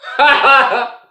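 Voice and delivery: loud, high-pitched laugh. Real, not acted. Laughing after telling his story.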